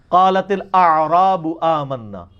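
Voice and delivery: slow, measured Arabic recitation; Quranic recitation. Shown as a demonstration. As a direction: recite slowly with full Arabic consonants. Quotes Arabic verse in recitation.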